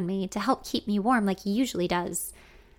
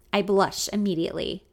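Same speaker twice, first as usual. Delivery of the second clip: ordinary delivery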